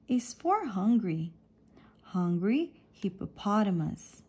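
usual speaking voice

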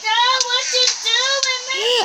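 loud, high laughing call. Real, not acted. Kid laughing loudly at dad in the game. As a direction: laugh through the words, slow.

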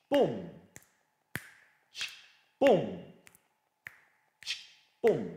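BOOM, punched and falling, widely spaced; each BOOM a steady drum hit; strong accent. Shown as a demonstration. Teacher beating out the rumba structure, strong accented BOOMs. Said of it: "a strong accent on count one".

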